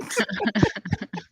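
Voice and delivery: high breathy burst of laughter. Real, not acted. [name] laughing at his own barbershop joke, high laugh.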